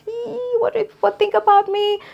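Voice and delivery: embarrassed inner voice. Retold or imitated.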